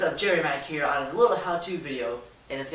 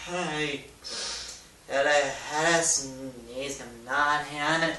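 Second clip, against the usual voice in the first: slow strained dumb voice; holding in a sneeze; much slower than usual. Shown as a demonstration. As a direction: talk like a sneeze is stuck. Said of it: "make a dumb face".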